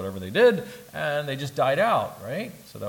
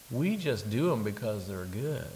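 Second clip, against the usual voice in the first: usual speaking voice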